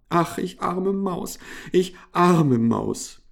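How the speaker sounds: high, plaintive mouse lament; self-pitying wail. A narrator's reading. Hungry mouse bewailing herself to the hamster, plaintive. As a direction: wail like a poor little mouse.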